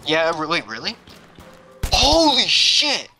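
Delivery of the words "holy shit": high, drawn-out amazement; astonished exclamation. Real, not acted. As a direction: stretch it out slowly in high-pitched shock. Amazed by game feature.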